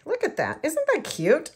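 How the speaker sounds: high, loud delighted gush; excited. Real, not acted. Gushing to viewers over the finished flowers, excited. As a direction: squeal it over something adorable. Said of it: "sorry, I get excited".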